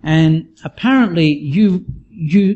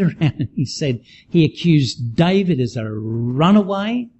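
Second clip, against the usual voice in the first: ordinary talk, nothing marked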